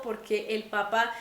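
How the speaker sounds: ordinary talk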